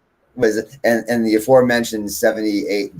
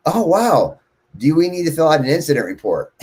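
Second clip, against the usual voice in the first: lightly voiced receptionist, higher pitched; imitated receptionist; 8 semitones higher than usual. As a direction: mimic her reaction in a raised pitch. Receptionist's reaction, acted out.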